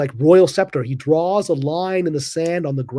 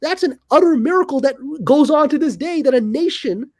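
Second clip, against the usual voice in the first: much higher, ringing with wonder; awed excitement; 9 semitones higher than usual. Real, not acted. Host marvels to guest at Ethiopia's lasting peace, awed and much higher. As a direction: lift the voice as if describing a miracle.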